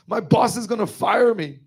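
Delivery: crying while telling it, pitch high and strained; choked up, high and tearful; tearful, voice breaking. Real, not acted. Retelling Mother's plea to the audience, crying. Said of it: "And I was crying".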